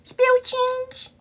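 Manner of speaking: high, slow sing-song call to viewers. Real, not acted. Calling out to chat for spare change, high drawn-out sing-song. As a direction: stretch it out high like calling the room.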